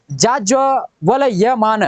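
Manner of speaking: जाज्वल यमान drawn out syllable by syllable; very slow spelling out. Shown as a demonstration. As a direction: slowly sound out जाज्वल यमान. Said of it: "लेकिन ये गलत है".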